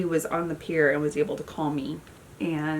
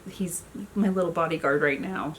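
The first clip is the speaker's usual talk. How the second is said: usual speaking voice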